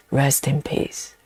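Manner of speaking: low, slow graveside intone; a playful hint of a funeral voice; mock spooky tombstone voice.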